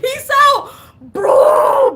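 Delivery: high and loud, dismissive; emphatic brush-off. Retold or imitated. She loudly dismisses a forty-six-year-old suitor, high and excited. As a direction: blow him off with big energy. Rejecting a much older suitor.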